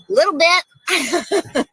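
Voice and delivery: high, slow, drawn-out "little bit"; sheepish admission. Retold or imitated. Actress retelling nervous reply to co-star, high and slow. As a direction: stretch it out, high and bashful. Retold answer to Olivia.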